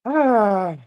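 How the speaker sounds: sudden high, loud gasp; surprised gasp. Acted. NPC reacts to hug.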